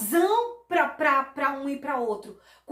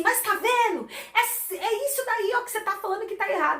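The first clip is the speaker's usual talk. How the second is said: mock argument; 8 semitones higher than usual. Spouse pointing out the repeated words, mock heated. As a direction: play the spouse catching them out. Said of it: "E a pessoa tá falando assim".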